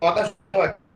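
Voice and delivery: choppy online call audio; radio breaking up. Real, not acted. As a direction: voice dropping out on a weak connection. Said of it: "কথা শোনা যাচ্ছে না".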